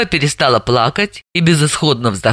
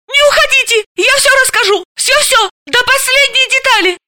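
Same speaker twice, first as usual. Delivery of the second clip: panicked squeal. Acted. Suspect shrieking at departing detective, panicked squeal. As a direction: shriek to stop her leaving. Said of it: "взвизгнула Заматаева".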